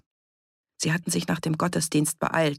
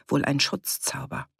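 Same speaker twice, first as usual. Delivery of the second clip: ordinary delivery, nothing marked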